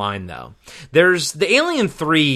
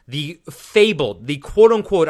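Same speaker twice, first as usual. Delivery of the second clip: ordinary talk, nothing marked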